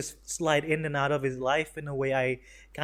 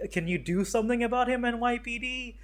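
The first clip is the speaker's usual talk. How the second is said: higher, faster, indignant call for help; hammed-up pleading to the cops; mock outraged plea; 9 semitones higher than usual. Bystander begging the NYPD, mock outraged.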